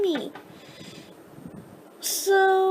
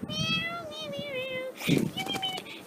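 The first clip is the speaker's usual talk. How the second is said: tiny kitten meows; 7 semitones higher, 9 dB quieter than usual. Pretend. Playing the kitten's voice.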